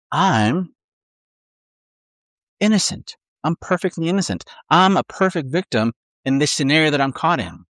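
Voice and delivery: lightly voiced smug victim, innocent pitched high; a hint of a preening self-righteous voice; self-righteous inner voice. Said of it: "a lie that says".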